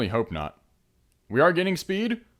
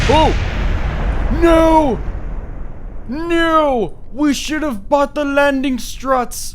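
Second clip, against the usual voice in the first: high, loud cry of dismay; real dismayed yell; 8 dB louder, much slower than usual. Real, not acted. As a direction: yell out as the craft hits the ground. Rocket crashes on landing.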